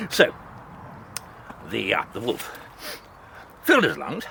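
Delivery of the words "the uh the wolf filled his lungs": ordinary delivery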